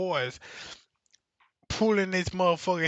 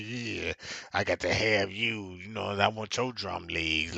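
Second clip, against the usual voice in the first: mock seductive woman; 8 semitones lower, 4 dB quieter than usual.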